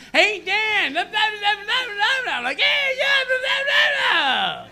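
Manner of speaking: very high, squeaky mock voices; garbled bar chatter. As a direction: imitate noise you can't make out. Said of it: "I just hear vibes like".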